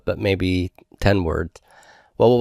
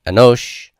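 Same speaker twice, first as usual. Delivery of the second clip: anosh said with a strong, drawn-out O; stress on final syllable; 3 semitones higher, 4 dB louder than usual. Shown as a demonstration. Teacher repeating the Hebrew example, O of anosh stressed. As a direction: put the weight on the O of anosh. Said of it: "The accent is on the O of anosh".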